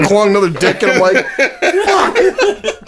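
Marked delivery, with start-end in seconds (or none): 1.9-2.9 mock dismayed curse. Realizing the dick texts.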